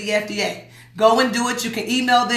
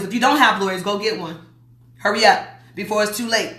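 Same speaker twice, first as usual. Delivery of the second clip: normal talking voice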